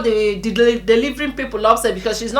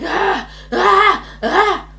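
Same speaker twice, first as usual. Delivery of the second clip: raised, repeated "ah"; re-enacted pain cries; 7 semitones higher, much slower than usual.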